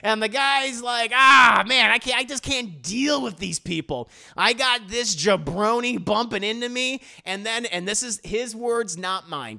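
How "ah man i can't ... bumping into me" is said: loud, higher-pitched mock griping; hammed-up fed-up workman's complaint; exasperated worker. Worker complains about being bumped.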